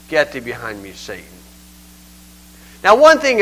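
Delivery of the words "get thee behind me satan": low quiet rebuke. Retold or imitated. Jesus rebukes Peter, retold.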